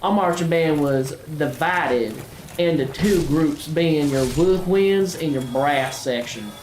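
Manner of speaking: lightly hammed Southern country accent; put-on Southern accent. Gamer telling viewers a band story in a mild Southern accent. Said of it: "I talked like this".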